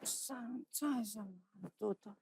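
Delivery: very soft, slow mumble; sleepy, barely-there voice; drowsy request. Acted. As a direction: ask faintly, half asleep. Drowsy request for bedtime story.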